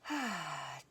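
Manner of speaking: long settling sigh; audible deep breath. Shown as a demonstration. Opening breath of guided meditation.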